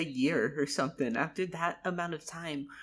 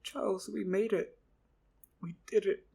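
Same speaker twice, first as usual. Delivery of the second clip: emotional relief; 5 semitones higher than usual. Acted. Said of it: "I got, like, a bit emotional".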